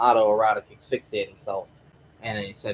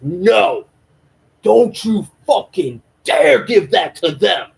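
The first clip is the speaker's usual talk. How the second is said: much higher, louder, slow enraged shout; furious; 10 semitones higher, 10 dB louder, slower than usual. Acted. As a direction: spit each word out in rage. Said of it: "he's getting fucking angry".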